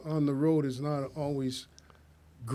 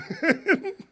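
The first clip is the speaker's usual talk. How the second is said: sudden high laugh; high burst of laughter; 13 semitones higher, 3 dB louder than usual. Real, not acted. Laughing while retelling the fight.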